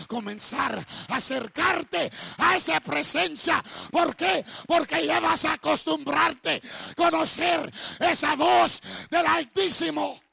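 loud, high preacher's cry; preaching shout. Acted. Preacher calling the church toward God's voice, shouting. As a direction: preach it out at full force.

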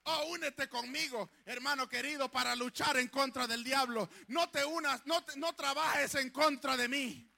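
rally the flock, building to a shout; fervent preaching. Real, not acted. Pastor urging the church to resist the devil, loud and high.

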